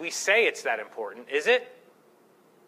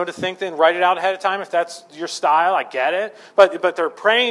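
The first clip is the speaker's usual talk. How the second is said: ordinary talk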